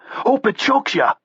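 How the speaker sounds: high, slow, weepy Boston yell; weepy Boston accent. Acted. Bleeding man taunting his opponent, weepy Boston accent. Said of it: "weepy Boston accent".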